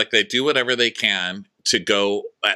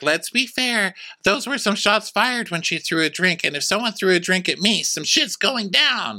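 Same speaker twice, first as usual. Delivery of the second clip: much higher, slowing into some shit's going down; sassy Ashley; 11 semitones higher than usual. Retold or imitated. Ashley defending the drink throw, high sassy put-on voice.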